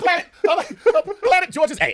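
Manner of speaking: high, loud squeal through real laughter; helplessly cracking up on the words; laughing hard. Real, not acted. Comic cracking up at the planet's joke name, laughing hard.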